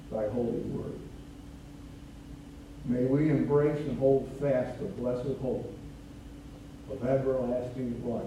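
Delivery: low, softened praying voice; hushed, low-pitched prayer with long pauses; solemn prayer. Real, not acted. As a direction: pray reverently in measured phrases. Leads the congregation in prayer.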